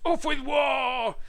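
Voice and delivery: high, very loud, slow shout; full-voiced tyrannosaur chant. A narrator's reading. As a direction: bellow a drawn-out war cry. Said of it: "they shouted".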